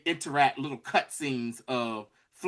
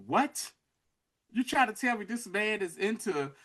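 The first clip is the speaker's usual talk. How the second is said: higher, incredulous questioning; acted disbelief; 5 semitones higher, 4 dB quieter than usual. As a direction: replay your stunned reaction to the scene. Own reaction to the odd scene.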